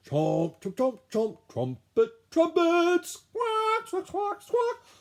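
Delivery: high, loud chomps, trumpets and squawks; very high squawking animal voices; animal noises. Acted. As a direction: play the zoo animals one by one. Animals making music.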